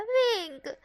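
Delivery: high loud cry of his name; protest. Acted. Wang protesting at Wing's teasing, loud high cry.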